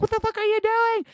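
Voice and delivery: high, loud mock outrage; shocked outburst. Date to the man advancing on her, shocked outburst. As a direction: shriek it in retold disbelief.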